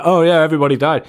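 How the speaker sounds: loud, fast, breezy mock shrug; mock casual report. Mocking future news of the tunnel deaths, loud and flippant. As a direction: toss it off like nobody cares. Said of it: "And then it's gonna be like".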